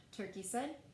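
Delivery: ordinary delivery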